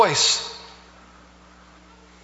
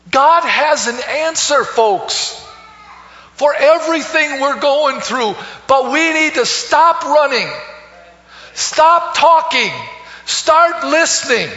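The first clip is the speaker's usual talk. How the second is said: higher and louder preaching, building to the final alone; full-force preaching; 8 semitones higher, 4 dB louder than usual. Acted. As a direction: drive the closing call home to the congregation. Sermon climax at full force.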